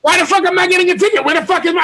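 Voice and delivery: high, rapid mimicked complaint; high-voiced mock indignation; mock outrage. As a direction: imitate an indignant rant, pitched high. Mimics her complaint about the ticket.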